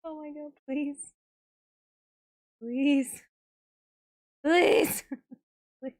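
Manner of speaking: desperate, building plea; pleading. Real, not acted. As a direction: plead harder with each please. Begging the fiddly task to work.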